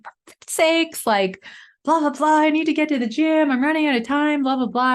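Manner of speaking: high, loud frustrated rant; retold angry yell. Stuck in a snowdrift before dawn, yelling in frustration. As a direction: vent like you're stuck in the snow. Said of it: "so angry and yelling".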